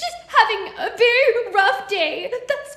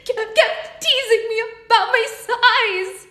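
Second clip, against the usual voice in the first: much higher, louder, tearful; fairy crying over her punishment; 12 semitones higher, 10 dB louder than usual. Acted. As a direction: sob through the excuse. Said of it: "the fairy said and cried some more".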